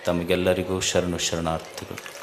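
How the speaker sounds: low, soft, devout salutation to the gathering; hushed formal greeting before a sermon; solemn invocation. Acted. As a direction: open the sermon quietly and reverently.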